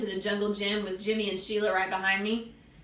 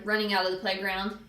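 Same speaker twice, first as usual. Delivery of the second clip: usual speaking voice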